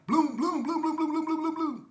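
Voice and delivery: loud, high bursts of mock gunfire; imitated gunshots. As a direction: blast out the shots like rapid fire.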